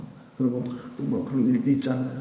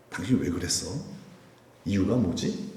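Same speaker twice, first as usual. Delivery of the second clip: softer, lower, calm questioning; gentle asking; 5 semitones lower, 7 dB quieter than usual. Retold or imitated. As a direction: ask gently, wanting to hear why. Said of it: "하면서 좀 사연을 들어보자". (화내지 않고 차분하게 묻는 배우자)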